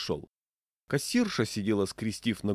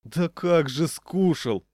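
drawn-out, high wail of protest; exasperated moan; 7 semitones higher, 5 dB louder, much slower than usual. A narrator's reading. Diner protests he never ate it, exasperated moan. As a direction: groan it out slowly in despair. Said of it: "застонал я".

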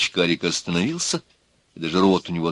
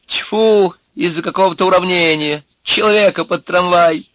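high, loud womanish outrage; deeply indignant; 10 semitones higher, 6 dB louder than usual. A narrator's reading. Nervous man reacts to the killing, sincerely indignant. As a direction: spit it out in a woman-like voice. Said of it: "Искренне и глубоко возмутился человек женским голосом".

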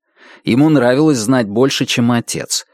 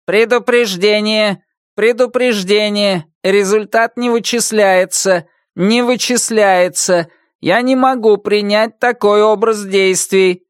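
robot voice; 9 semitones higher than usual. Acted. Robot on laptop warns.

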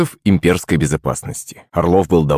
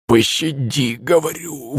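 strained, pitched-up hoarse plea; blurted plea; 5 semitones higher, 4 dB quieter, slower than usual. A narrator's reading. Beaten guard repeating his plea, blurted and strained. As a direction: blurt it out on what little voice is left. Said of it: "выпалил он".

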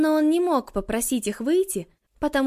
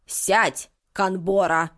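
stern witch command; 6 semitones lower, much slower than usual. Acted.

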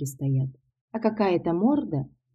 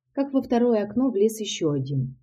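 plain reading voice

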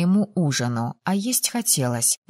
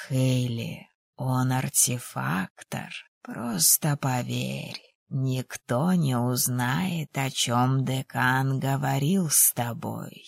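low, slow, softened confidant; hushed, unhurried reassurance pitched low; Kosha's persuading voice; 5 semitones lower, 5 dB quieter, slower than usual. A narrator's reading. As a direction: coax her quietly, taking your time.

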